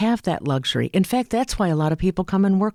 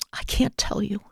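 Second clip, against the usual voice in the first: hushed, voiceless whisper; 5 semitones higher, 4 dB quieter than usual. Retold or imitated. Grandmother whispering her secret to her granddaughter. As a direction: breathe it out like a frightened secret. Dying grandmother afraid to confess.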